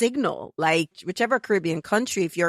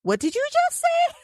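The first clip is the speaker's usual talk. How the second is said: much higher, stunned coworker voice; startled coworker. Retold or imitated. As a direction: squeak it out as a baffled colleague. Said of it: "I was like".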